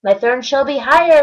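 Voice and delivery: loud royal boom; thundered. Acted. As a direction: thunder the command. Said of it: "his royal voice thundered".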